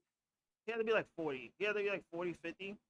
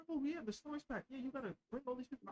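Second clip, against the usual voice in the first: mocking manager voice; 7 semitones higher, 8 dB quieter than usual. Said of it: "mocking management".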